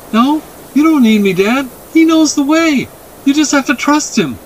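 put-on high-pitched, emphatic child voice; insistent boy. A narrator's reading. Boy urges his dad to trust the horse, insistent. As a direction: plead like a boy, high and urgent.

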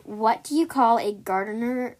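ordinary delivery

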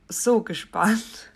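giggling through the words; laughing. Real, not acted. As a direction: laugh mid-word, slowed down. Excited about new course.

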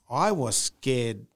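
ordinary delivery

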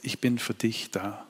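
hushed, tender promise. Acted. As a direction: say it gently, as God's reassurance. God's name, said tenderly.

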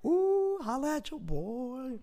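high, slow showy brag; a squeaky drawn-out self-hype; mock boast. Bragging about four listeners, high slow mock boast. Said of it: "self-hype about listeners".

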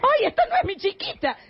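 high, startled cry of alarm; sudden panic over the child; alarmed mother. Acted.

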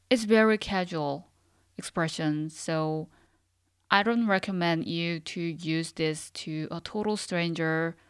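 ordinary talk

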